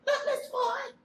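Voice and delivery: put-on high, loud, eager child calling out to the teacher; excited child. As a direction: show off your new skill to the teacher, thrilled. Child excitedly showing her swimming.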